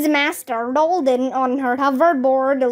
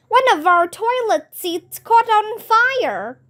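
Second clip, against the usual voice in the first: alarmed shout; 8 semitones higher than usual. A narrator's reading. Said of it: "shouted Mister Klutz".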